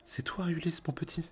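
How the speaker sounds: high, broken half-whisper of joy and tears; the old nurse chokes up as she recognises him; voix brisée, aiguë, presque chuchotée. Acted. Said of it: "Elle dit d'une voix brisée".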